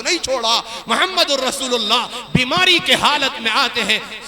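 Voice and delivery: forceful preaching, words drawn out; exhorting. Acted. Preacher urging prayer on time, exhorting. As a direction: drive the command home to the congregation.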